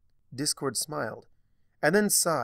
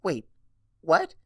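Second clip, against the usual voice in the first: much higher, much louder startled yelp; shocked cry; 8 semitones higher, 8 dB louder than usual. A narrator's reading. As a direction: jolt out the question in disbelief. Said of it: "Twilight cried".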